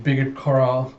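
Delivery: plain reading voice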